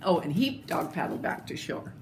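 plain reading voice

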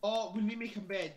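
normal talking voice

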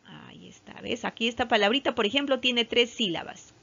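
ordinary delivery

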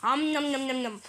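playful nom-nom chomping; cartoon eating sounds; greedy munching noises. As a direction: gobble hay with voiced nibbles. Cow munches hay again.

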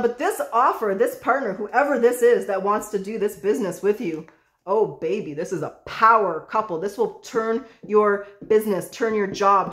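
normal talking voice

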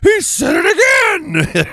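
high, slow, shouted whiny mockery; mock shriek of a scandalised viewer; mocking outraged viewer. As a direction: squeal it like an offended viewer. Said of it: "People are like".

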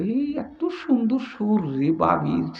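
softly marvelling under the breath; thinking to himself. Acted. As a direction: play a man's private smitten thought. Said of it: "মনে মনে কয়".

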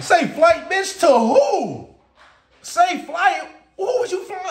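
high, loud, incredulous comeback; outraged retort. Acted. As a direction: throw it back in disbelief, drawing out the end. Outraged retort to the pilot's announcement.

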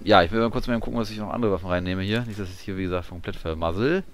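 ordinary talk, nothing marked

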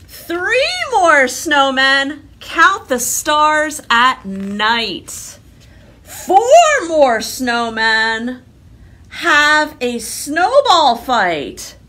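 slow loud storybook reading; reading to children. Acted. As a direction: read it out slowly and grandly for kids.

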